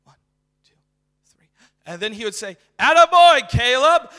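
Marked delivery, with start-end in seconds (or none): 2.8-4.1 loud, high proud yell across the room; imitating dad's yell. Imitating his dad yelling before his son plays, loud and high. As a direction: holler it like a proud dad at a recital. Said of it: "my dad would always yell something".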